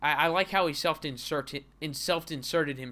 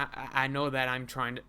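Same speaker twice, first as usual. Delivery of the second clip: normal talking voice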